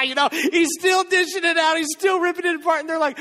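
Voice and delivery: high, loud, driving sermon voice; fired-up preaching. Acted. Preacher building the miracle story for the church, loud and fired up. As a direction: preach it with rising excitement.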